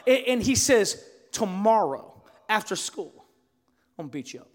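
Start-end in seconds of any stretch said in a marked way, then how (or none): 1.3-4.5 low, increasingly quiet menace; retold threat. Bully warns the seventh grader about tomorrow, quiet threat. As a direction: imitate a bully's threat, dropping away at the end.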